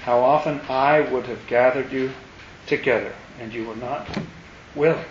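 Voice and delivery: put-on low, soft, weary old man's voice, only lightly done; a hint of an aged, sorrowful voice. Shown as a demonstration. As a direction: read it as an ancient, tired God who has watched all of history. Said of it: "This is an old, old voice".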